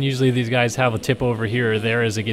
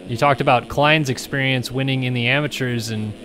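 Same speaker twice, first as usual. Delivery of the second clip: ordinary talk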